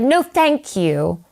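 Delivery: slow, clipped transatlantic "No, thank you"; transatlantic accent. Retold or imitated. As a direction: refuse in a transatlantic accent, slowly. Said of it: "a transatlantic accent".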